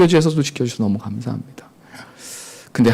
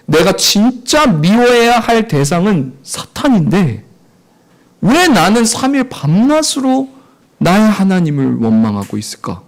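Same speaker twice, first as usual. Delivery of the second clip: intense preaching; 6 semitones higher, 4 dB louder, slower than usual. Real, not acted. Preacher's rising self-questioning.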